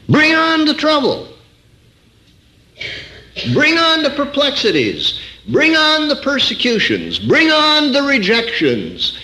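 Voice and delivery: high, ringing, repeated challenge; preacher crying out high over the congregation; defiant preaching. Acted. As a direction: throw down the gauntlet to trouble. Preaching at full force.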